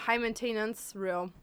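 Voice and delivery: normal talking voice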